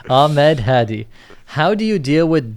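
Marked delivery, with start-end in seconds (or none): 0.1-1.1 slow, loud Ahmed Hadi in Arabic accent. Shown as a demonstration. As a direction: draw out the name the Arabic way. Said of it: "I say it with the Arabic accent".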